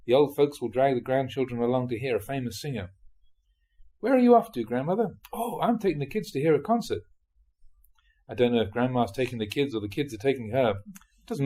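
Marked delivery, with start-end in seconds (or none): 4.0-7.0 higher, lightly voiced neighbour and grandmother; a hint of chirpy dialogue; lightly acted chat. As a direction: play a casual doorstep exchange, pitched up a little. Acted exchange with grandmother in anecdote.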